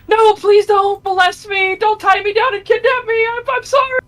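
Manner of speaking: high, loud hammed-up begging; squealing hammed-up panic; mock terrified pleading. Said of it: "He goes".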